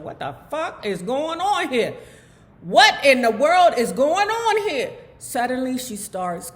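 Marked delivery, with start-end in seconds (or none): 0.0-4.9 rising panic, getting louder; alarmed inner voice. Retold or imitated. Hospital patient frozen in bed, asking herself in rising panic. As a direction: she can't understand what's happening to her body. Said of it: "Like saying to herself".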